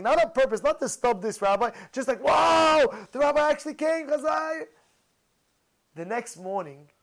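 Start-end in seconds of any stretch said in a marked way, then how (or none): 2.2-4.7 excited crowd exclaiming; amazed exclaim. Retold or imitated. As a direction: gasp it out like people who can't believe he came. Crowd marvels as rabbi enters.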